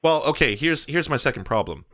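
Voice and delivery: ordinary talk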